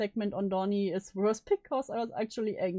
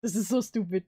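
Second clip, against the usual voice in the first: ordinary talk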